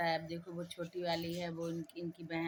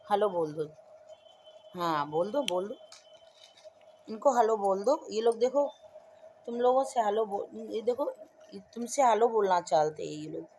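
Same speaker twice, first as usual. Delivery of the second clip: loud coaxing; 4 semitones higher, 10 dB louder than usual. Real, not acted. Mother loudly urging her child to greet the viewers.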